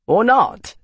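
high, loud, cheerful challenge; bluff, cheerful. A narrator's reading. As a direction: jump in boldly, spoiling for a fight. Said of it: "said a bluff, cheerful voice".

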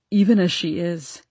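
words squeezed through tears; choked out. Acted. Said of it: "choked out".

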